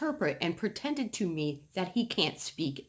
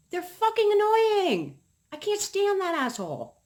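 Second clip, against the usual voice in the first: exasperated rant; 12 semitones higher, 5 dB louder than usual. Real, not acted. Venting about the neighbor to viewers, high loud exasperation. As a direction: spit it out fed up, pitched way up.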